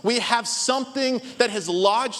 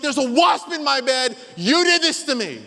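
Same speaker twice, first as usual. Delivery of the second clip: angry accusation; 6 semitones higher, 4 dB louder than usual. Retold or imitated. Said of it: "And he's like".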